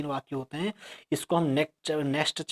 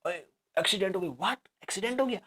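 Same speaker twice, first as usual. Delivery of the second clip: startled surprise; 3 semitones higher, faster than usual. Shown as a demonstration. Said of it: "जिसको देखकर आदमी चौंक जाए".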